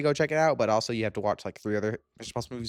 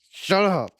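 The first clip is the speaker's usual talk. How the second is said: mock snap; 6 semitones higher, 6 dB louder than usual. Teasing herself about crying.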